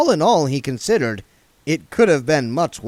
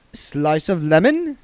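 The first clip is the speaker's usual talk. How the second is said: high, fussy genteel voice; prissy old vagrant, fussing; duck man voice; 7 semitones higher, 4 dB louder, slower than usual. A narrator's reading. Duck man offering lemon.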